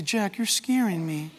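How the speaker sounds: low, soft, slowed-down objection; uneasy churchgoer. Acted. Churchgoer objecting to the preacher, in a hushed voice. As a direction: quietly voice a spooked listener. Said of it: "You say".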